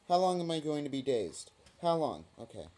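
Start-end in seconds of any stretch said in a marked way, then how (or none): none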